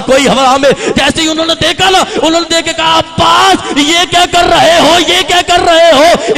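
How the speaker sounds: loud, high climactic sermon cry; full-force preaching. Acted. Preacher narrating Abbas's charge at a loud, high climax. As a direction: roar the battle scene to the gathering.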